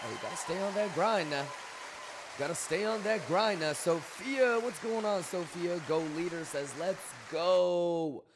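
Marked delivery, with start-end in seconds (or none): 0.0-5.8 high, loud hype-man shout-out; hyped greeting. Real, not acted. Streamer greeting viewers who join, hyped. 6.8-8.2 loud, drawn-out rallying call; hyped cheer. Real, not acted. Streamer answering a viewer's message, hyped cheer.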